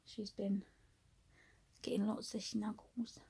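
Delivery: soft unvoiced whisper. Real, not acted. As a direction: whisper so the cat stays asleep. Whispering beside sleeping cat.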